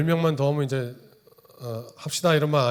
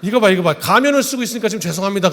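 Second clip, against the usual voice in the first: laughing; 7 semitones higher, 8 dB louder than usual. Real, not acted. Pastor laughs mid role play.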